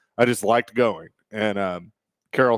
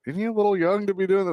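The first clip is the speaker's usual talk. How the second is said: high, fast worried coworker; quick, higher mock-concerned question; imitating a coworker; 9 semitones higher, faster than usual. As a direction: fret lightly that the kid is too young. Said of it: "somebody in the kitchen was like".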